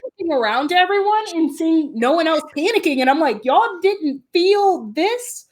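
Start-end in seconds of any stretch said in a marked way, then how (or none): 3.4-5.5 high, drawn-out disbelief; mock incredulity. To calm passengers as the plane drops, mock incredulity. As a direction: stretch it slowly, acting baffled by everyone.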